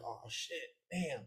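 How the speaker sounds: hushed curse. Retold or imitated. Hiding from cops' flashlight.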